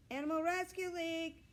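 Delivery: high, loud call from outside; yelled through a door. A narrator's reading. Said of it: "someone yelled through the door".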